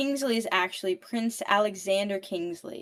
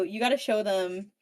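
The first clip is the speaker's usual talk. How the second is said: ordinary delivery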